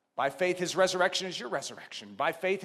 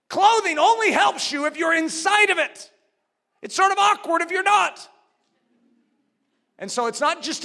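raised, emphatic preacher's voice; forceful preaching; 8 semitones higher, 7 dB louder than usual. Real, not acted. Preacher insisting you be inside the clothing, forceful preaching. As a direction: drive the point home loudly.

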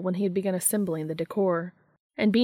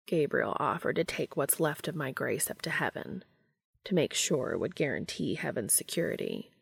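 low, hushed character voice; Cass; 4 semitones lower, 5 dB quieter than usual. Acted. Cass explaining Gabriel's offer to Dean, low and soft. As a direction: keep it soft and deep, measured.

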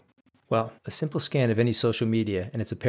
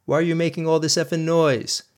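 higher, louder angry yell; husband yelling at his wife at night, angry shout; retold shout; 6 semitones higher, 4 dB louder than usual. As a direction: shout at her like a raving lunatic. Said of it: "I shouted like a raving lunatic".

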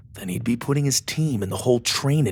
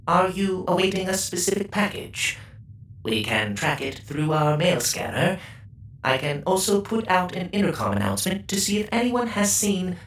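higher, precise robot helper voice; polite robot; 10 semitones higher than usual. Acted. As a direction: speak like a courteous machine. Robot chef bot speaks.

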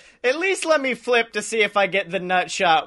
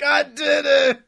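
triumphant yell; 8 semitones higher, 4 dB louder, slower than usual. Retold or imitated. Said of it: "as I'm like".

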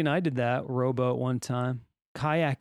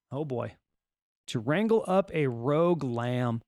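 ordinary delivery

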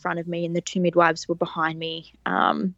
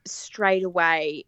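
usual speaking voice

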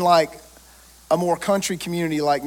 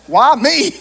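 higher, louder whine of protest; mock reluctance; 6 semitones higher, 7 dB louder than usual. Pastor acting out his dread at being asked to pray, mock reluctant. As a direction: play the put-upon pastor. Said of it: "And I'm like".

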